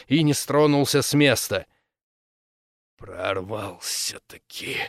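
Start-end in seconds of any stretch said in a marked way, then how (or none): 3.0-4.8 strained, forced out slowly through clenched teeth; through gritted teeth. A narrator's reading. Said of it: "сумел он выдавить сквозь зубы".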